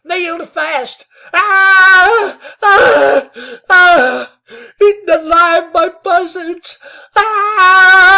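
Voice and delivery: very high, very loud shrieks, near crying; man nailed to a tree shrieking at circling buzzards; terrified shrieking. A narrator's reading. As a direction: shriek in horror until the voice breaks. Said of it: "He shrieked until his voice failed".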